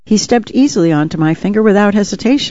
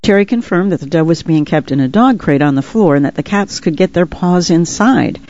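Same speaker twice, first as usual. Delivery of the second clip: usual speaking voice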